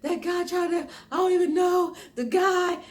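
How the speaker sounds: very high, frantic blurting; high-pitched acted panic; retold adrenaline panic. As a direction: re-enact breathlessly reporting an attacker to police. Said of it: "my adrenaline was pumping so hard".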